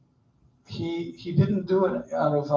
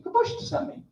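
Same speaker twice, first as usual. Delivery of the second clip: much higher, meek little reply; timid Moses; 14 semitones higher, 4 dB quieter than usual. Retold or imitated. Moses meekly answers mighty Pharaoh, high timid voice. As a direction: shrink back as a nervous Moses.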